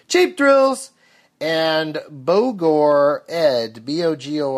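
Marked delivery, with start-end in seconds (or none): 0.1-0.9 jingle-style tagline. Acted. The same tagline repeated.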